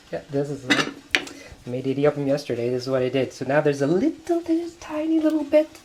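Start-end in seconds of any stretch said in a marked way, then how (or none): 3.9-5.8 very high squeaky voice; playful high squeak; tiny voice. Pretend. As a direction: make the words as small as the bit left. Squeaky tiny voice for small amount.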